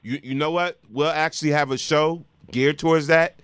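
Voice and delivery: normal talking voice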